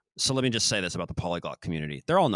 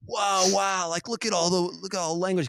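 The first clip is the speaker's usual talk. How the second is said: much higher mock-awed voice; mock amazement; 11 semitones higher, slower than usual. Mocking viewers awed by polyglot videos, high and gushing. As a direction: gush in fake wonder. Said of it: "everybody will go".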